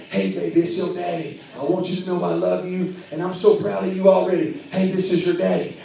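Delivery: put-on low-pitched, loving talk to the unborn baby; proud daddy promising protection; tender father. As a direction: reassure the baby in a deep, gentle voice. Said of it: "I would lay my hands on the belly and I would say".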